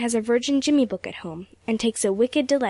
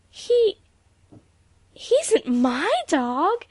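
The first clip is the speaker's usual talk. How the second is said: high, slow, stammered shock; stunned high-pitched denial; gasped protest; 9 semitones higher, 4 dB louder, much slower than usual. A narrator's reading. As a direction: gasp it out, aghast. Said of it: "gasped Emily".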